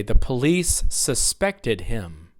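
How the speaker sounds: slow, sus-PECT-ed with a strong middle; second-syllable stress. Shown as a demonstration. Teacher leads repetition of the verb, sus-PECT-ed. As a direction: hit the PECT in "suspected". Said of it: "verbs are stressed on the second syllable".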